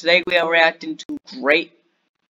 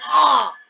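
sharp, very high pained ah; anguished cry; 9 semitones higher than usual. Real, not acted. Host seeing Megaphone die, sharp high cry. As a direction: cry out as it dies. Reacting to the death.

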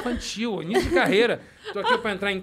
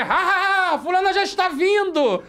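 loud, high forced stage laughter running into the line; hammed-up stage acting; 9 semitones higher, 5 dB louder, slower than usual. Actor stalling onstage in a children's play, hammed-up. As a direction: project a fake jolly children's-play voice.

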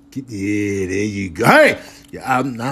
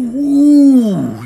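high, loud delighted ooh; excited ooh; 12 semitones higher, 10 dB louder than usual. Real, not acted. Enjoying the cat's head rub, delighted. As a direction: let the pleasure burst out.

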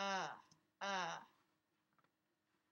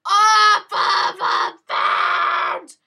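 loud, high, word-by-word yell; exasperated high-volume commands; frantic shouting; 9 semitones higher, 14 dB louder, much slower than usual. Real, not acted. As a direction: shout each word at the game. Urging character over a fence.

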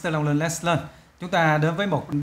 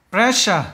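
"Pressure" with PRES punched, then falling away; first-syllable stress; 2 semitones higher, 4 dB louder than usual. Shown as a demonstration. Teacher shows students the stress, first syllable of "Pressure". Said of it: "âm đầu luôn".

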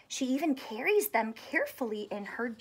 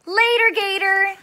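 character line; 8 semitones higher, 10 dB louder than usual. A narrator's reading. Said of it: "said Chris".

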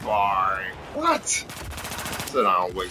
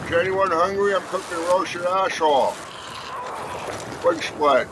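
mock host voice; 10 semitones higher than usual. Taunting opponent at his vehicle.

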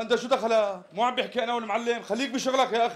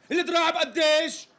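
exasperated demand; 9 semitones higher, 4 dB louder than usual. Acted. Customer loses patience again.

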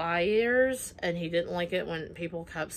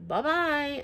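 higher mock-sweet bye-bye; sing-song dismissal; 8 semitones higher than usual. Dismissing the ex, teasing sing-song. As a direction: wave him off teasingly.

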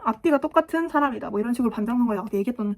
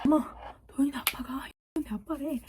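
put-on whispery child telling mom; hushed retold gossip; 3 semitones higher, 5 dB quieter than usual. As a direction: imitate kids passing on news, very softly. Kids telling mom at home.

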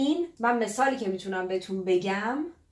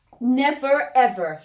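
"Never ever" drawn out very slowly, each word stressed; slow, firm "Never ever", ruling it out; emphatic never; 5 dB louder, much slower than usual. Shown as a demonstration. («Never ever» با تأکید و خیلی آهسته)

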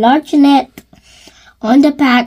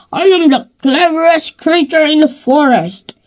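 boastful wolf voice; 4 semitones higher than usual. Acted.

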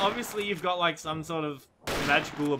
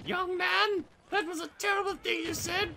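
high-pitched imitation of a friend's mother; mock mom; 13 semitones higher, 4 dB quieter, slower than usual. Gamer imagining friend's mom meeting him, high mock scolding. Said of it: "she's just gonna be like".

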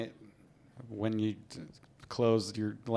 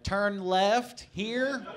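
higher, very slow unsure rising tone; questioning; 8 semitones higher, much slower than usual. Pretend. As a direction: ask it like you have no idea. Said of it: "ask everything in questions".

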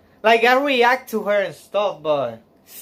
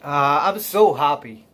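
tearful happiness; 7 semitones lower than usual. Real, not acted. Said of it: "If I cry, I cry".

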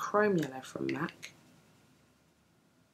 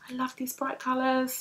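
much higher, gushing admirer voice; imitating admirers; 11 semitones higher than usual. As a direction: play the delighted stranger paying a compliment. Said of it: "It's like".

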